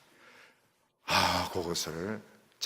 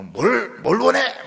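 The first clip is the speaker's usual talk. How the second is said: high, loud, eager question; eager call-out; 11 semitones higher, 11 dB louder, slower than usual. Retold or imitated. Preacher acting a lover who eagerly calls out. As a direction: burst out asking what she wants. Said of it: "뭐 이렇게 해야 되는데".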